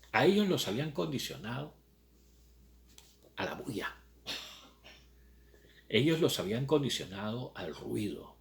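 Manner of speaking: ordinary talk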